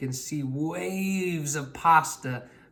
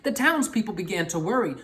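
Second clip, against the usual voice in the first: usual speaking voice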